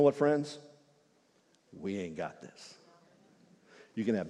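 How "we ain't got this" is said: softer, slower confession; hushed, humble honesty; soft admission. Real, not acted. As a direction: lean in and level with them. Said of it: "admitting need".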